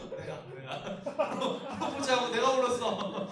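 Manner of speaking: loud laughing confession. Real, not acted. Pastor admitting he cried at his proposal, laughing loudly. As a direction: burst out laughing as you admit it.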